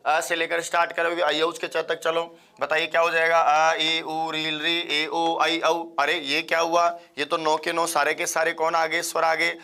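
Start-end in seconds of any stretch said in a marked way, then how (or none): none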